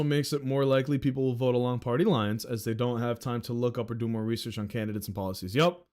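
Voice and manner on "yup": usual speaking voice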